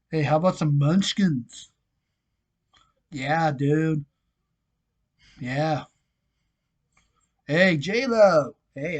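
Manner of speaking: playful put-on Boston accent, Dunkin' fan. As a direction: do a jokey Boston accent, dropping the Rs. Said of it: "Boston accent".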